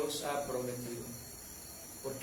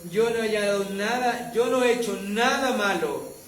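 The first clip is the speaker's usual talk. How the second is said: Daniel answering; 5 semitones higher, 7 dB louder than usual. A narrator's reading. Daniel calls up to the king from the den, solemn and raised.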